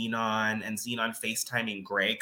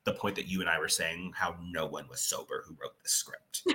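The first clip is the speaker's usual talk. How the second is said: ordinary delivery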